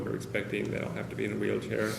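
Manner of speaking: ordinary talk, nothing marked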